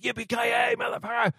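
high, yelled wrong way to say it; mock shouted catchphrase. Shown as a demonstration. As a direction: belt it out like a cheesy action hero. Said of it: "it's not like".

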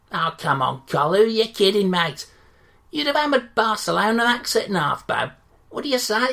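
loud, brash local radio reporter; loud cheery Yorkshire radio interviewer; sports interviewer. Acted. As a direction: gush at the manager like a matey sports hack. Interviewer presses the manager.

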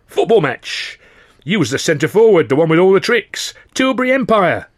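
high, loud delighted remembering; excited recollection. Acted. Big docker remembering the football match, excited. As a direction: point and recall it gleefully.

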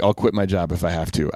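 ordinary talk

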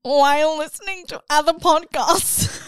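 high, stretched-out mock whine; put-on whiny voice. Reading a hate email aloud in a mock whiny voice. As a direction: whine it out like a fed-up listener. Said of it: "put on a voice".

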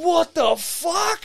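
high, slow incredulous cry; acted-out outraged astonishment; shocked disbelief. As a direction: stretch it out, pitched high, aghast. Retold shock at the waiter.

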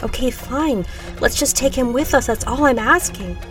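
pleading concession. A narrator's reading. Woman to group about the injured man, insistent pleading. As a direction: give in, then press the request.

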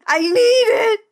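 high, drawn-out mock wail; desperate wail. Recapper voicing Laura begging for pills, high melodramatic wail. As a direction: whine it in hammed-up desperation.

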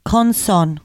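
"Conson" in exaggerated Nigerian accent; mock Nigerian accent. Shown as a demonstration. Host demonstrating accent hack, mock Nigerian accent. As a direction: put on a Nigerian accent for the word. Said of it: "this is the Nigerian".

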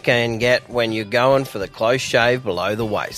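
steady sponsor read over music; a light radio ad voice. Acted. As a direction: pitch the product to listeners. Reads a sponsor ad.

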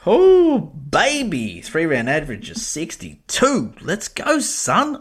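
crowing over a great pickup; excited cheer. Real, not acted. Buzzing over signing his free agent.

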